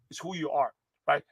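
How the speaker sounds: ordinary talk, nothing marked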